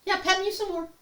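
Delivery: playful high pet voice; cat's voice. Pretend. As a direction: voice the demanding cat. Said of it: "she's like".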